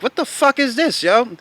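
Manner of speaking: high, heated outcry of disbelief; retold angry outburst. Student seeing his bad test grade, shouting in high angry disbelief. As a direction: blurt it out, pitched high and furious.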